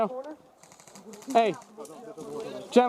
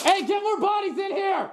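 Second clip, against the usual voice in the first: shouting; 9 semitones higher than usual. Real, not acted. Calling for reinforcements.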